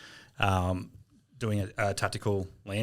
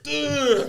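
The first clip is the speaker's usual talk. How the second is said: high strained groan of nausea; mock sick groan, laughing; queasy groan; 6 dB louder than usual. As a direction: voice a man about to puke. Navy guy about to vomit.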